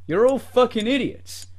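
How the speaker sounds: ordinary talk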